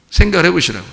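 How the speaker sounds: ordinary talk, nothing marked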